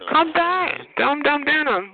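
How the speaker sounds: usual speaking voice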